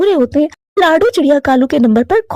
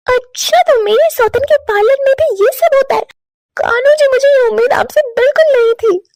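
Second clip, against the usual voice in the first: much higher, shrill accusation; betrayed wife; 9 semitones higher than usual. Acted. Chinki catching her husband hugging his ex, high accusing. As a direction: accuse him, hurt and furious.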